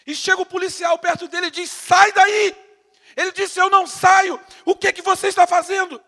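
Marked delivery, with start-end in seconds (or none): 1.7-2.6 loud high barked order; police command. Retold or imitated. Policeman orders him away. 3.6-6.0 loud, high, defiant refusal; shouting back at the policeman. Retold or imitated.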